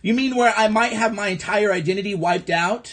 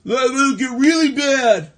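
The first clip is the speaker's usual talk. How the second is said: slow, ominous mock warning; mock doom; 2 semitones higher, 4 dB louder than usual. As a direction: drag it out like a fake scare.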